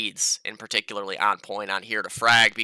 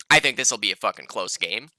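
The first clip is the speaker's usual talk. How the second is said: ordinary talk, nothing marked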